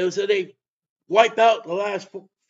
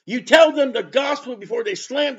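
loud, raised preaching outburst; emphatic correction; 4 semitones higher, 6 dB louder than usual. Real, not acted. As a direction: cut in forcefully and drive the point home. Correcting small-talk evangelism.

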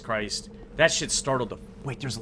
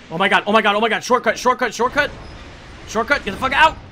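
higher, louder excited repetition; excited disbelief; 5 semitones higher, 5 dB louder, faster than usual. Real, not acted. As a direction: blurt it over and over, rising with excitement. Excited at finding a shortcut.

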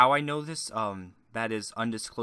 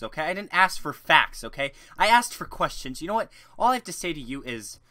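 higher, louder indignant ranting YouTuber voice; iDubbbz impression; 6 semitones higher, 3 dB louder than usual. IDubbbz fuming at a viewer's non-question, mock outrage. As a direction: rant like you've been tricked by the question. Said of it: "I can't fucking do an iDubbbz impression".

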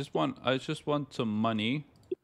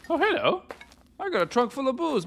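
excited; 13 semitones higher than usual. Real, not acted. Delighted discovery.

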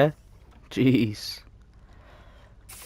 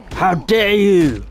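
mock outrage; 8 semitones higher, 4 dB louder, slower than usual. Mock outrage while fighting.

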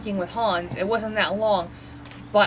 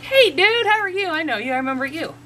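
much higher and louder, over the top; a dog thrilled to see a buddy again; voicing the dog meeting the neighbor's dog again, thrilled; overexcited dog greeting; 8 semitones higher, 6 dB louder than usual. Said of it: "Second time she met him".

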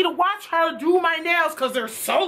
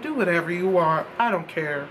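resigned giving up; 9 semitones lower than usual. Retold or imitated. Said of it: "And I was like".

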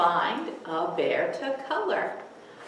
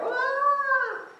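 much higher short cry; mock scream. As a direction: squeal for the kids. Acting the frightened scream.

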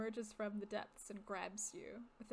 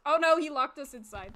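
sudden loud, higher burst of alarm; alarmed outburst; 5 semitones higher, 16 dB louder than usual. Real, not acted. Player realizing they are locked inside, alarmed outburst. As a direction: blurt it out in panic at being trapped. Player reacts to being trapped.

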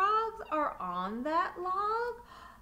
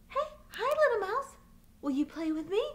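put-on high, bright, hopeful child voice; eager little duckling; 6 semitones higher, 5 dB louder, faster than usual. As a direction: ask sweetly to be friends. Little duck asks mouse to play.

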